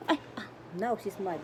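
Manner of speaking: ordinary talk, nothing marked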